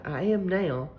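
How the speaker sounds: ordinary delivery, nothing marked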